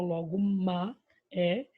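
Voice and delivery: ordinary delivery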